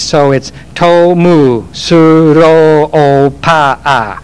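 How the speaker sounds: Tomu Suro Opa A, said slowly syllable by syllable; slow, careful Japanese reading of Tomu Suro Opa A. Shown as a demonstration. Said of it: "Tom Sloper in Japanese".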